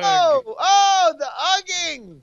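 high, drawn-out mock wail; hammed-up agony. Player pretending to suffer the ugging, wailing in mock agony. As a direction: cry out in theatrical torment. Acting out suffering in staged fight.

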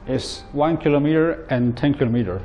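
ordinary talk

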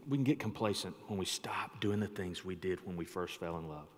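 whispered, unvoiced. Real, not acted. Pastor whispering a tender truth to the congregation. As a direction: lean in and whisper it. Intimate soft point to congregation.

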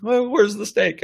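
high, slow, hopeful dog voice; eager dog voice. Retold or imitated. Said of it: "the dog goes".

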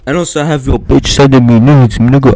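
loud taunting boast; shouting. Real, not acted. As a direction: yell the taunt in his face.

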